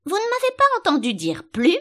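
high, loud, indignant question; angry cry. A narrator's reading. The sheep demands whether Alice heard her, crying angrily. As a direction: scold loudly at being ignored. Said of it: "cria la brebis avec colère".

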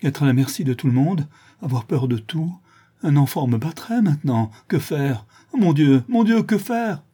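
high, helpless wail of words; desperate lament. Acted. Blinded man at everyone's mercy, desperate. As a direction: build to a high anguished appeal.

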